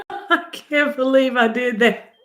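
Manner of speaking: high, slow, laughing words; laughing disbelief. Real, not acted. To viewers after accidentally going live, laughing in disbelief. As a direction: giggle through the disbelief.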